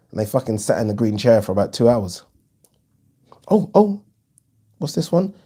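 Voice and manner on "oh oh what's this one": surprised. Real, not acted. Spots an interesting comment.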